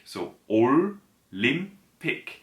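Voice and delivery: "Olympic" drawn out slowly, with no final S; slow model word. Shown as a demonstration. Teacher modelling the Korean use of "Olympic". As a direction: stretch "Olympic" slowly for learners. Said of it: "use instead the word Olympic".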